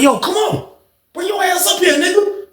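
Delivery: very high-pitched call-out; worked-up challenge called from afar; retold angry summons. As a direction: demand he come up, voice way up. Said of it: "I went up the steps angry".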